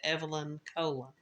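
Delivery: "Evelyn" with short e, "Colon" as KOH-lun; host's own pronunciation. Shown as a demonstration. Host closing a correction, "Evelyn Colon" said EV-uh-lin KOH-lun. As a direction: stress the first syllable of "Colon": KOH-lun. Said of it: "I pronounced it".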